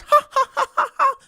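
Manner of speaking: high, mocking ha ha ha; mocking laughter. The world laughing at Romney, high mocking laughter. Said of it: "The world laughed".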